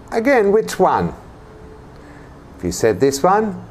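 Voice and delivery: ordinary delivery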